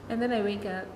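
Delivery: normal talking voice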